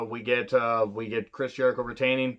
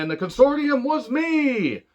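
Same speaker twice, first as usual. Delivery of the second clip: much higher, louder and slow, a hammed-up boss gloating; mock villain reveal; 13 semitones higher, 5 dB louder than usual. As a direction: belt it out like a cartoon villain unmasking himself. Quoting Vince's big reveal.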